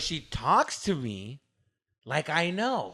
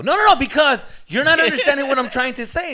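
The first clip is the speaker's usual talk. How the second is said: loud, high acted-out protest; heated insistence; 9 semitones higher, 9 dB louder than usual. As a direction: cut in and insist, exasperated. Acted quarrel, insisting over partner.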